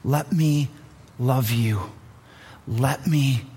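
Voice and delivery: tender invitation, letting each line sink; gentle Jesus. Retold or imitated. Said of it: "came in gentleness".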